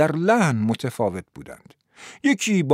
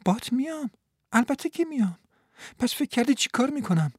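higher, softer, eager promise; eager woman's voice; 11 semitones higher, 3 dB quieter than usual. Acted. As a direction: insist lightly, she's coming along.

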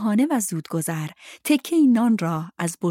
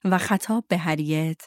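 plain reading voice

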